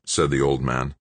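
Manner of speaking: ordinary delivery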